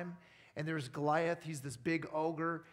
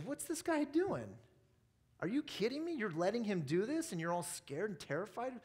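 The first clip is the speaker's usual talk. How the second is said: high-pitched disbelief, easing back down; incredulous; 4 semitones higher than usual. Retold or imitated. David to his hiding brothers about Goliath's taunts, incredulous. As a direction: act out an indignant young challenger in disbelief.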